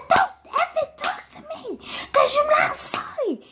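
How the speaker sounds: very high mock scream; imitated raging mom. Mom rejecting her child's apology, mock raging scream. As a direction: screech like a mother who has snapped. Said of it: "zero to hundred real quick".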